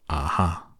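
ordinary delivery

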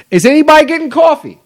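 loud, high call to the house. Real, not acted. Host calling out to the house for coffee, loud high call. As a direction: shout the question to whoever is around.

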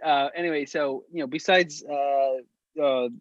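ordinary talk, nothing marked

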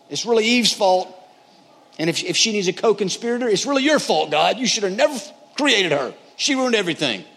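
high-pitched, indignant finger-pointing; blaming Adam. Acted. Adam excusing himself to God, indignantly blaming.

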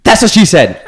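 mock Michael Scott delivery; Michael's catchphrase. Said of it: "And then Mike goes".

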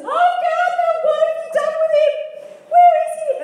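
high, loud, half-sung cry of Mary; anguished high call at the tomb; frantic cry. Acted. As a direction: wail it out, desperate to find him.

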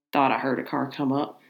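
a hint of an old Southern farmer, low and soft; lightly voiced old man with a Southern twang. Old farmer telling his wife about the car, in a low Southern tone. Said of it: "he said in a low tone".

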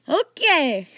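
loud, high, uneasy okay; trying to calm himself. A narrator's reading. Archie facing the dragon, trying to calm himself. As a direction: force out an okay to steady yourself. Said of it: "trying to calm himself".

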